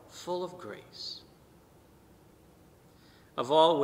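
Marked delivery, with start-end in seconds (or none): 0.1-1.2 slow, soft and hushed, far from his own voice; angel's greeting. Retold or imitated. Priest voicing the angel Gabriel greeting Mary, slow and hushed. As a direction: breathe it out slowly like the angel's greeting. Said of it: "He says, "Hail".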